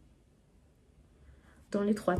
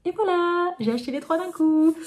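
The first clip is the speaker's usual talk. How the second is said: high, loud, triumphant announcement; spring the news on them, delighted; excited reveal; 9 semitones higher, 6 dB louder than usual. Real, not acted. Booktuber reveals her trilogy haul to viewers, excited.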